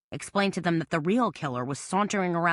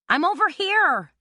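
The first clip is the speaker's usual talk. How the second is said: high, loud shout over wind and rain; shouting to be heard; 13 semitones higher, 6 dB louder than usual. A narrator's reading. Carmela shouts to Babcock above the wind, loud. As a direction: yell it across the woods. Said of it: "trying to make herself heard above the slashing wind".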